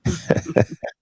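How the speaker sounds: chipmunk giggle. Pretend. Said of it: "Do your chipmunk voice".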